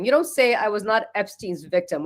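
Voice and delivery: normal talking voice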